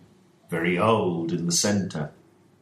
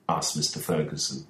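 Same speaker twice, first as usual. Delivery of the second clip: ordinary delivery, nothing marked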